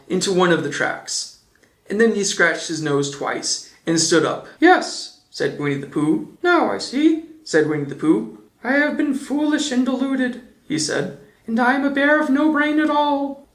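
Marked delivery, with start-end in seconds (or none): 4.6-5.2 a high, light little bear voice; Pooh voice. A narrator's reading. Pooh's voice. 6.4-7.3 a high, light little bear voice; Pooh voice. A narrator's reading. Pooh's voice. 8.6-10.4 a high, light little bear voice; Pooh voice. A narrator's reading. Pooh's voice. 11.5-13.5 a high, light little bear voice; Pooh voice. A narrator's reading. Pooh's voice.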